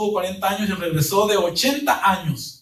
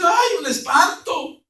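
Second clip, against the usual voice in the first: a slow, much higher mock cry of fright; frightened cry; 11 semitones higher, 5 dB louder, slower than usual. Disciples in the boat seeing Jesus at midnight, slow mock cry of fright. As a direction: cry out like you've seen a ghost.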